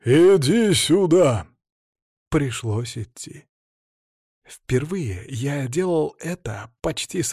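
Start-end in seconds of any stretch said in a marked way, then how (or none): 0.1-1.5 loud, slow booming command; booming voice from sky. A narrator's reading. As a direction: thunder the order out. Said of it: "прогремел голос Владимира".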